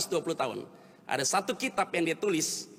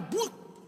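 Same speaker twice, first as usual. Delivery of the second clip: short high "buk" thud; imitated crash; 13 semitones higher, 5 dB quieter than usual. Preacher imitates an earthquake bringing things down, imitated thud. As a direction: voice a sudden collapse in one syllable. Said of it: "Biar kata gempa datang".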